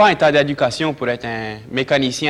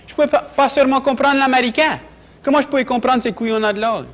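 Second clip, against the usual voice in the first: exasperated, much higher-pitched outburst; exasperated protest; 11 semitones higher than usual. Acted. Dropout recalling his struggle to understand English, exasperated. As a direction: protest in a high, indignant pitch. Acted monologue, exasperated at failed schooling.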